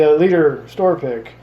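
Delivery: normal talking voice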